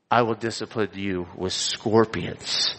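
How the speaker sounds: low, soft, menacing king's threat. Acted. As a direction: drop the voice and threaten. King threatens harsh rule.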